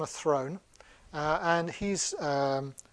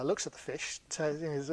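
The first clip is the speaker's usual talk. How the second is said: ordinary delivery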